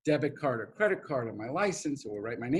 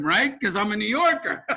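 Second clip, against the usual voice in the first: normal talking voice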